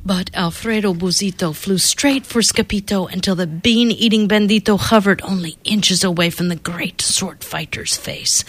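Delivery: plain reading voice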